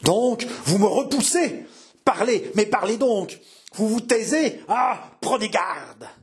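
high, menacing demands from a rejected suitor; seething anger. A narrator's reading. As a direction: press for an answer, then draw out the threat. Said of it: "grinça des dents et haleta".

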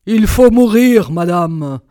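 loud, stern death sentence; harsh, commanding villain voice; menacing Bluebeard. A narrator's reading. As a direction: pronounce the sentence forcefully. Bluebeard condemns his wife to die.

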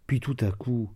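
ordinary delivery

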